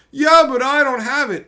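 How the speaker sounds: high, loud mocking whine; whiny mock excuse. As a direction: parody the player's lame excuse. Mocking a combo opponent.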